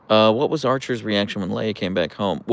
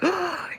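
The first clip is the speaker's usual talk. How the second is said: sharp high gasp of delight. Real, not acted. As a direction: gasp as if overwhelmed by cuteness. Dog does cute hand thing.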